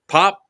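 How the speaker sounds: a loud, sharp "pop" gunshot noise; mock gunshot. Opening fire on the tunnel squad, a loud mouth-made gunshot. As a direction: spit out "pop" like a rifle shot. Imitating gunfire during airsoft story.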